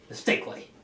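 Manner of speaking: normal talking voice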